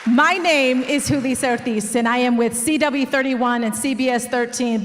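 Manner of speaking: usual speaking voice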